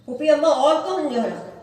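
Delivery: put-on a little girl pressing her aunt for an answer; eager child's question. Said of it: "Sughra کا اشتیاق بڑھتا جا رہا ہے". (بےتاب بچی کا سوال، اونچا اور تیز)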